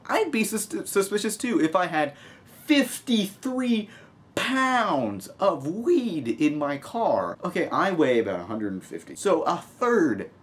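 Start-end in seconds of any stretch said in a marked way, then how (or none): 2.6-7.4 high, very slow, stretched-out words; drawn-out emphasis. Real, not acted. Vlogger marvelling at a teacher's weed haul, slow stretched emphasis. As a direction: savour every word of the amount.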